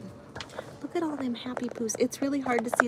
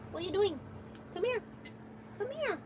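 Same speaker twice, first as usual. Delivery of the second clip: pet talk; 10 semitones higher, 8 dB quieter, faster than usual. Real, not acted. Calls her other cat.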